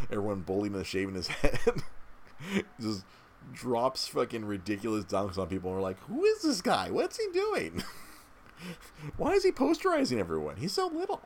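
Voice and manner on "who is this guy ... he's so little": high, incredulous squeal of surprise; amazed disbelief. Retold or imitated. Mock amazed fans watching dunks.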